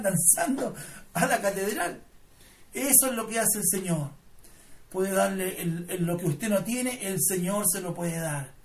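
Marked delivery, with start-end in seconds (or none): none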